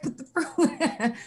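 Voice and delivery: laughs through the words put the fruit in; a light chuckle mid-advice; laughing. Real, not acted. Doctor laughs while telling viewers to flavor water with fruit.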